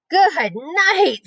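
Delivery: put-on boy screaming at a rotten smell; disgusted scream. A narrator's reading. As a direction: yell it out in revulsion. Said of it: "screamed Avery".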